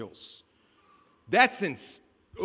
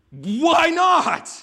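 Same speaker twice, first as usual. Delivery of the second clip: high, loud comic shout of disbelief; panicked shout; 8 semitones higher, 9 dB louder than usual. Acted. Servant back at his calm master, panicked shout. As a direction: yell it like a servant in mock panic.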